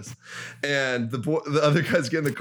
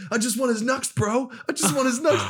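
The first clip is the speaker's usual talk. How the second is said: high-pitched imitation of an insistent plea; mock pleading; 11 semitones higher than usual. Homeless guy insisting to the friend, high mock pleading. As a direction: plead as the odd stranger, much higher.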